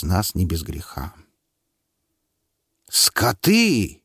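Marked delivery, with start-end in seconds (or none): 2.9-4.0 angry. Acted. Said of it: "яростно метнул".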